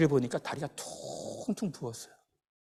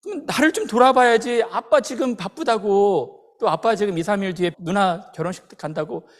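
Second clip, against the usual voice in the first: loud, high, exasperated scolding; angry outburst; 5 semitones higher, 8 dB louder than usual. Retold or imitated. Father showing his injured son how to get angry, indignant and loud. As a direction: erupt in raised-voice protest, acting out the father. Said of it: "확 화가 치밀어 오르더래요".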